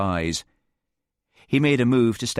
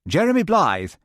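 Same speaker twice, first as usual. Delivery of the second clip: put-on young man voice; 9 semitones higher, 4 dB louder than usual. A narrator's reading.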